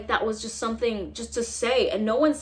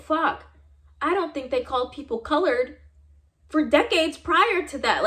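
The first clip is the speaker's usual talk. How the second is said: exasperated outburst; 6 semitones higher, 5 dB louder than usual. Real, not acted. Rejecting the professor's excuse to viewers, loud exasperated outburst. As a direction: burst out at how absurd the excuse is.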